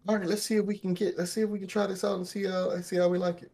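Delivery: eager group dare. Retold or imitated. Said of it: "and we was like".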